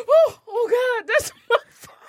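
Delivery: high panicked realisation; high, dismayed oh God. Retold or imitated. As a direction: gasp out in dismay. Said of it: "I said".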